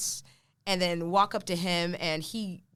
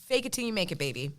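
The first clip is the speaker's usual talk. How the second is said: ordinary delivery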